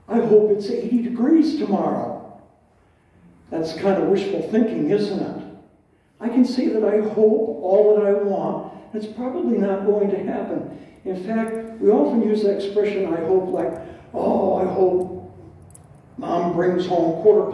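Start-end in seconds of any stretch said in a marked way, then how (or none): none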